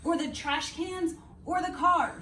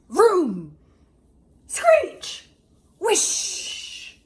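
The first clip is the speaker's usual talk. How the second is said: loud, high vroom, screech and whish; bus sound effects; 5 semitones higher, 8 dB louder, much slower than usual. A narrator's reading. Narrator imitating a bus rumbling up to a turtle. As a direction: make the bus noises come alive.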